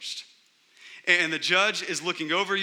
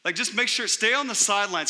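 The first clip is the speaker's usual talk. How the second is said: higher, mildly louder mock scolding; hammed-up nag to stay out of trouble; mock stern warning; 5 semitones higher, 5 dB louder than usual. Grudging judge telling the freed man to stay out of trouble, mock scolding. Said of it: "Not only does he say".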